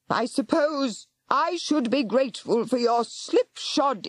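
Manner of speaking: shouted. Acted. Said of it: "she shouted".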